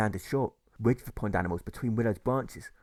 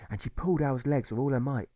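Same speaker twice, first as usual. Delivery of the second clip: ordinary delivery, nothing marked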